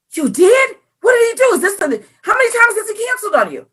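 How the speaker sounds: high-pitched, acted-out disbelief; incredulous questioning. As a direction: fire off puzzled questions, speeding up.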